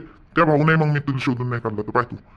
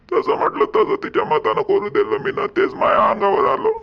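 crying. Acted. Father sobbing over the runaway daughter, voice cracking high. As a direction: voice breaking into a sob, pitched high.

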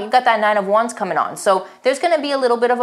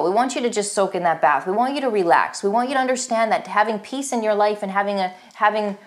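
ordinary delivery

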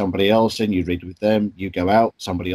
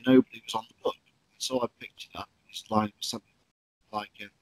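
ordinary talk, nothing marked

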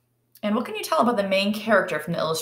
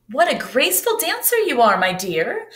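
high, louder delighted praise; exclaiming; 5 semitones higher, 3 dB louder than usual. Acted. Dance teacher praises Bronterina, high and delighted. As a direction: gush over the dancer. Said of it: "Madame Loisel exclaimed".

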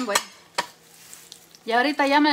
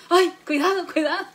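mock alarmed warning; 4 semitones higher, 5 dB louder than usual. Warning husband while driving.

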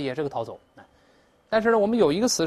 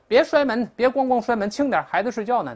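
higher, louder telling-off; acted scolding; 7 semitones higher, 4 dB louder than usual. Parent tells someone the child is sleeping, acted higher-pitched scolding. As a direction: scold a door-slammer in a raised pitch.

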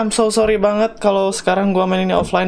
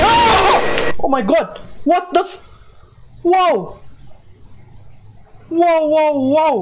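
terrified scream; 8 semitones higher, much slower than usual. Real, not acted. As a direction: scream at the jumpscare then catch your breath. Jumpscare in horror game. (jeritan ketakutan lalu terengah kaget)

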